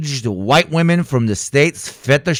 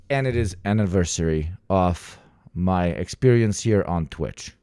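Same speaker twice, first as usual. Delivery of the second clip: ordinary talk, nothing marked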